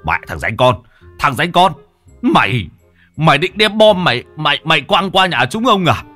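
mock-angry village uncle; furious relatives. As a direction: threaten the bomb-bringer with a beating. Angry relatives grab Đồng.

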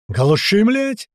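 loud shout leaping high on the curse; shouted order. A narrator's reading. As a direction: yell over the chainsaw. Shouted order to kill the saw. (раздражённый крик)

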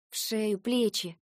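a touch of irritation; slightly irritated. A narrator's reading. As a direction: throw it out, mildly annoyed. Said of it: "чуть раздражённо бросила я".

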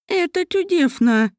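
high, slow gasp of delight. Acted. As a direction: marvel at the lake. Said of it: "восхитилась Мариэль".

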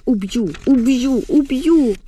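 high, strained repeated death threat; uncontrolled hysteria. A narrator's reading. Mariana attacks her mother, beating her, hysterical. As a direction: wail the threat in rising frenzy. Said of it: "істерику своєю невтримною".

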